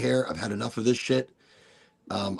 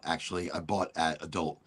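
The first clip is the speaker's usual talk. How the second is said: usual speaking voice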